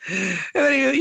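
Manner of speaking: ordinary delivery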